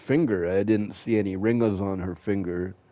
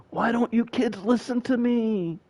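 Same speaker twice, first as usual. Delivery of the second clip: whiny mom; 12 semitones higher than usual. Retold or imitated. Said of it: "And then be like".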